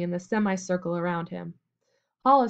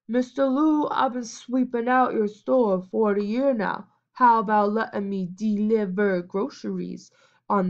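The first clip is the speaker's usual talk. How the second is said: mocking servant imitation; 2 semitones higher, 3 dB louder than usual. A narrator's reading. Major mocking Alfred as an old servant, lightly voiced. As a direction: ham up a groveling old servant to mock Alfred. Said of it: "continued his imitation".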